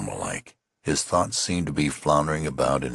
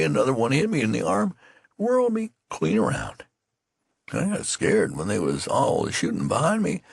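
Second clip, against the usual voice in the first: put-on high, folksy old soldier voice telling his tale; a wounded private spinning his yarn, voice lifted high; lightly voiced country soldier recalling being shot; rustic wounded soldier; 11 semitones higher than usual. A narrator's reading.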